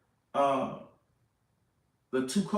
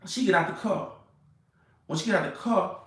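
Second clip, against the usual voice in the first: normal talking voice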